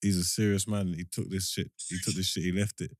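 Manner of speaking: usual speaking voice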